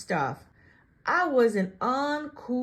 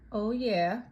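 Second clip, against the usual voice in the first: plain reading voice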